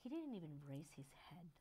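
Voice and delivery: a wounded, whispered complaint; hurt whisper. Retold or imitated. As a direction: whisper it as if offended. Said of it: "it's just be like".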